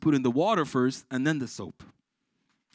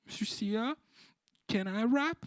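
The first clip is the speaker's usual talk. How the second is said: put-on child voice; 8 semitones higher than usual. Child asks teacher.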